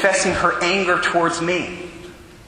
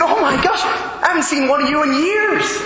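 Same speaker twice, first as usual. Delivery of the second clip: much higher, thrilled and amazed; excited waiter; 9 semitones higher than usual. Retold or imitated. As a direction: gush like you can't believe your luck. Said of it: "He said".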